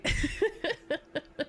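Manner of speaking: bright high giggle falling away. Real, not acted. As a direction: laugh off your doubt. Laughs at own doubt.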